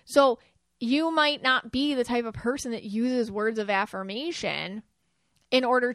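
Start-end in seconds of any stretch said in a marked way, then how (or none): none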